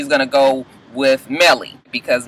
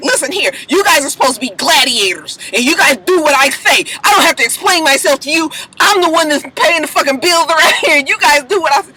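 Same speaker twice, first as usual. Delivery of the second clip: higher, mock-furious rant at the team; angry rant; 9 semitones higher than usual. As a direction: play Olivia laying down the law, hammed up. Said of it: "storms up to him and is like".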